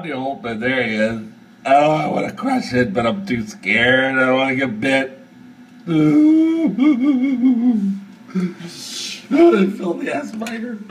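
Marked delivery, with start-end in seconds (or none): 5.9-8.0 high laugh. Real, not acted. Laughing at the spider.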